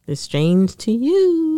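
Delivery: slow, with "you" drawn out; stress on "you". Real, not acted. Correcting co-host about accents, "you" drawn out. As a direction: stretch out "you" to make the point. Insisting on her point after interruption.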